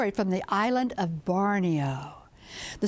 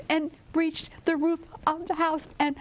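ordinary delivery, nothing marked

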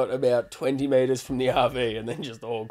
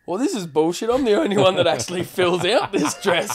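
higher, laughing grumble; laughing mock complaint; 5 semitones higher than usual. Complaining only he fills out the dress, laughing.

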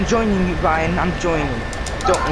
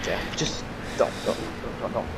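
whispered pleading; 2 semitones lower, 8 dB quieter, faster than usual. Real, not acted. Begging friend to stop.

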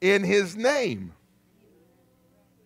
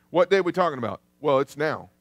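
ordinary delivery

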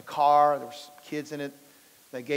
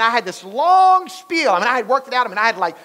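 ordinary talk, nothing marked

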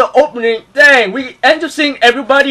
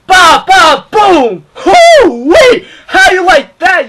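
shouted fight sounds; 9 semitones higher, 7 dB louder, much slower than usual. Retold or imitated. Mimicking attack, hyped.

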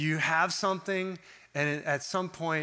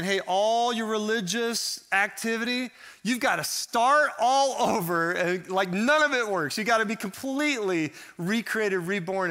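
a fervent call to start over; urgent Jesus; 4 semitones higher, 4 dB louder than usual. Retold or imitated. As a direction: voice Jesus pressing hard on them. Preacher voicing Jesus' urgent call.

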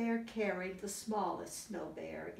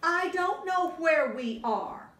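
higher, louder little bear; high snow bear voice; 9 semitones higher, 8 dB louder than usual. Acted. Biggest snow bear teasing Mommy, high playful voice.

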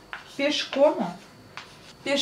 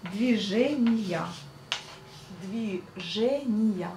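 1 slow repeat; 3 dB quieter, much slower than usual. Shown as a demonstration. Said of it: "repeats slowly for learners".

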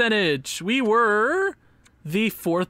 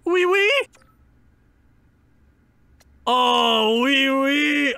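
playful French oui oui, pitched high; a jokey Quebecois oui oui; lightly hammed-up French accent; mock French; 5 semitones higher, 4 dB louder, much slower than usual. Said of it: "Le Montreal Canadiens".

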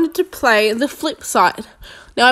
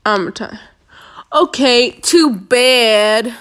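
normal talking voice

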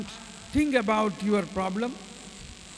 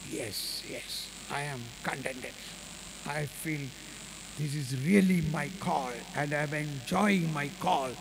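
quiet reply; 6 semitones lower, 6 dB quieter than usual. Retold or imitated. Sister answers priest quietly.

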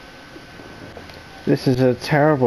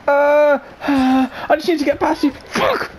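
high strained plea rising to a squeal; panicked whine; 13 semitones higher than usual. Real, not acted. Player needs to get past an android, panicked whine. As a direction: whine desperately, then squeal the curse.

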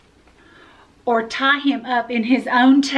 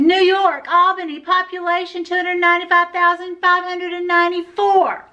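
higher, fast rattled-off know-it-all; interrupting; 7 semitones higher, 4 dB louder, faster than usual. A narrator's reading. Camilla interrupting the teacher, fast and eager. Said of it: "Camilla interrupted".

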